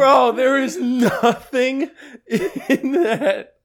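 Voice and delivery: high, slow, laughing disbelief. Real, not acted. Laughing at old video.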